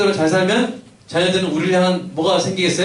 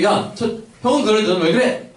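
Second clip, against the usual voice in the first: comparing scold; 6 semitones higher than usual. Retold or imitated. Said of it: "이렇게 하는 거야".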